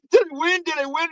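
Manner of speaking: high-pitched eager mock blurting; frantic excitement. Dad bursting into the radio station, mock frantic excitement. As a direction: imitate a desperate contest entrant.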